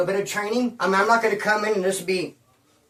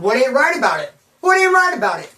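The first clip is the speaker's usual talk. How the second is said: second What much higher and louder, building to a yell; indignant demand; 4 semitones higher, 6 dB louder than usual. Retold or imitated. Retelling arguing with Glenna's friends, an escalating indignant yell. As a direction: challenge them, rising from sharp to shouting.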